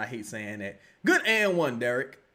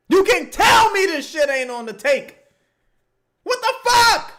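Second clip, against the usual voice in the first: furious shout; 10 semitones higher, 8 dB louder than usual. Real, not acted. Accusing the game is rigged.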